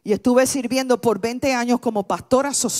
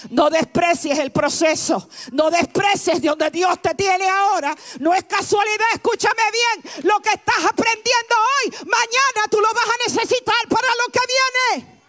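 much higher, shouted sermon climax; full-force preaching; 10 semitones higher than usual. Acted. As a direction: preach it out to the whole room, full throttle. (predicación a todo pulmón, gritando)

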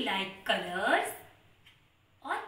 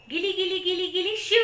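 higher, louder magic spell chant; kids party magician; 6 semitones higher, 6 dB louder than usual. Pretend. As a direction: cast the spell with a flourish. Magic spell over mixed colors.

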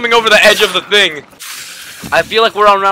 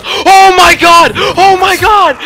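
shocked; 11 semitones higher, 5 dB louder, slower than usual. Real, not acted. Boss appears.

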